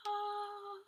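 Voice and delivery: high held "ah", lightly sung out; heavenly "ah". Retold or imitated. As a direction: sound a soft angelic note of relief. Imitates light on reaching the street.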